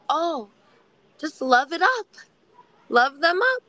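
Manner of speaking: breezy advice, pitched high; cheery psychologist. Retold or imitated. Psychologist brushing off her request, high and cheery. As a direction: toss it off lightly and brightly. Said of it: "And they would say".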